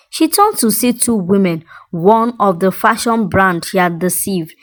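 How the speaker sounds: plain reading voice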